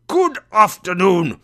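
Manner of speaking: loud, high shout; furious dismissal. A narrator's reading. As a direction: shout him out of the room. Scrooge's final dismissal.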